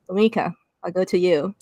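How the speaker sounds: ordinary talk, nothing marked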